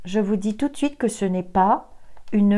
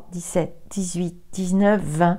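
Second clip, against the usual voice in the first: ordinary talk, nothing marked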